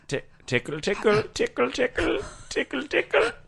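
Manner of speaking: playful tickling chant climbing up; giggly tickle attack. Acted. Tickling her, rising voice.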